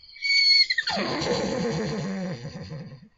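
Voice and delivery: whinny like a horse, shuddering down from the top. Shown as a demonstration. Vlogger showing off a horse impression, loud whinny. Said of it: "this is my horse impression".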